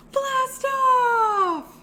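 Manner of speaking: high, loud, drawn-out cheer; launch shout. Pretend. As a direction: shout it like a rocket taking off. Rocket countdown launch in song.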